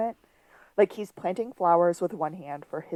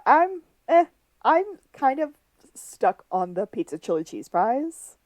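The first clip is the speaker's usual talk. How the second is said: usual speaking voice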